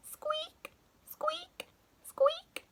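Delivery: very high whispered squeaks; high squeaky trainer noise; squeaky shoe. A narrator's reading.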